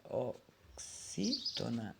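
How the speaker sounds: "oxítona" soft and slow, in separate syllables. Shown as a demonstration. Teacher working out the word at the board, syllable by syllable. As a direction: say "oxítona" softly, piece by piece.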